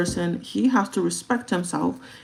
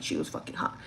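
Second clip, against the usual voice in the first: whispered aside; 9 dB quieter than usual. Real, not acted.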